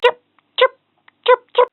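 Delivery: high, squeaky chirping; bird chirps. Acted. Toy showing the child what a bird says, high chirping. Animal sounds game.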